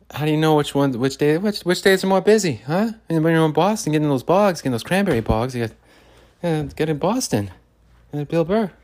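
high, loud Boston comic rant; Bill Burr impression. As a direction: pitch up and bark it like Bill Burr.

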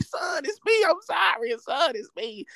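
high, frantic mother's plea; pleading mom. Retold or imitated. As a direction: voice the mom calling it's me, high and urgent. Mom pleads after being kicked.

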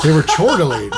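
chuckling through it; laughing while saying it. Real, not acted. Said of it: "They started to chortle?".

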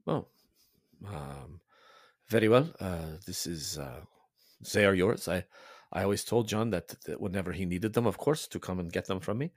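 soft, lightly voiced Italian priest, gentle and hopeful; a hint of a kindly father wishing John well. The priest hearing of John's retreat, softly Italian.

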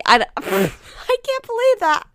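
high, loud laughing disbelief. Real, not acted. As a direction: crack up at your own bit. Cracks up at own bit.

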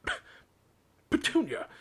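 stammered gasp bursting into a high, loud cry; horrified, spluttering call to his wife. A narrator's reading. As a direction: choke on the name in shock. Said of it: "he gasped".

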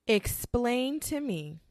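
ordinary talk, nothing marked

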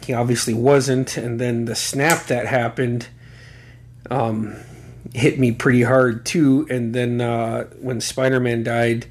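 ordinary talk, nothing marked

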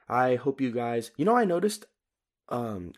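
normal talking voice

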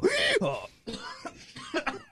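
high squealing donkey bray; hee-hawing donkey. Pretend. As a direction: bray like a donkey, laughing through it. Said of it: "that was my donkey impression".

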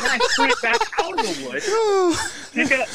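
squeaky high, slow, laughing words; real helpless laughter. Real, not acted. Golfer retelling his run from the woods, helpless with laughter. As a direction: wheeze the words out through laughter. Storyteller cracking up at his story.

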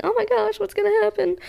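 high-pitched panicked inner voice; panicked thought. Retold or imitated. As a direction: squeak it out like sudden panic. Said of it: "by thinking".

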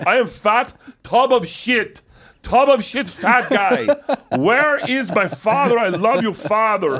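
put-on very high, slow, stilted foreign-accented English; Kazumi's broken-English accent. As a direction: mimic a non-native speaker declaiming lines at high pitch. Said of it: "he's not great with English".

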